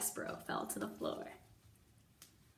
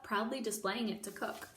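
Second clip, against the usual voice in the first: ordinary delivery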